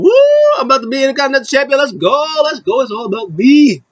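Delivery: high, whooping imitation of a victor; celebrating. Wrestler celebrating his coming title shot, mock jubilant. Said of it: "Chad Gable is just celebrating".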